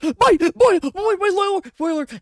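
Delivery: high, panicked stammering lady; terrified scream. Acted. Lady Sophie sees her lord leap into the fire, shrieking. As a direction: shriek it in terror, tripping over words. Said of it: "screaming with absolute terror".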